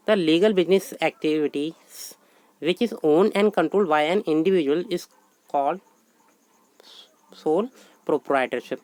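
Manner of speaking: slow, measured dictation of a definition. Acted. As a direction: read it out word by word for notes. Teacher dictates definition in English.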